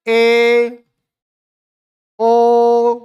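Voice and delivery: ए and ओ each held long and level; prolonged pluta vowels. Shown as a demonstration. Teacher continues the list of pluta vowels, ए and ओ drawn out. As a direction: stretch ए, pause, then stretch ओ just as long. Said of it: "प्लुत होते हैं गुरुजी".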